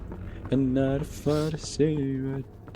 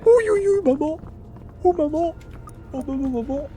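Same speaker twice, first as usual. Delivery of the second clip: panicked squeal; 13 semitones higher than usual. Real, not acted. Scared crying for mom.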